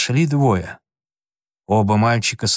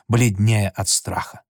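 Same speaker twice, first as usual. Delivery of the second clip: usual speaking voice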